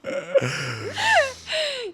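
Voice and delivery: a real burst of high, squealing laughter; helpless shriek of laughter. Real, not acted. Laughing at Russian joke.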